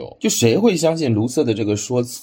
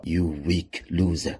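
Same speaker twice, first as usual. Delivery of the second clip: soft, scornful sneer; John Wick scoffs at the news; quiet, dismissive put-down; contemptuous; 4 semitones lower, 6 dB quieter than usual. Acted. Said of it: "轻蔑一笑".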